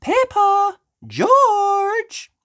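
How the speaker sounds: calling out. Acted. Mummy calls Peppa and George, loud high call. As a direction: call the children from afar. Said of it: "called Mummy Pig".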